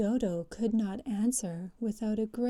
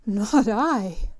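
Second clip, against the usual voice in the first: hasty; 10 dB louder than usual. Acted. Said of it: "said the Lory hastily".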